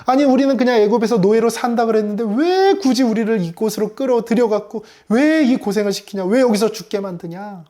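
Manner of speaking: high-pitched mock protest; resentful complaint. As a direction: complain like an aggrieved crowd blaming their leader. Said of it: "이렇게 원망하는 거예요".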